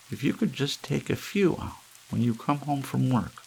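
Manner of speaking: a touch of a dim, kindly housewife; Hazel's voice. Acted.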